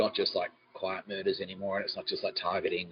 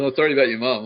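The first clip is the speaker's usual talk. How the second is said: 1 fake apology; 5 semitones higher, 9 dB louder, faster than usual.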